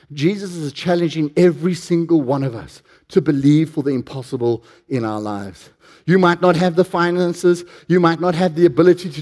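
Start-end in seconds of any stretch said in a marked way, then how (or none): none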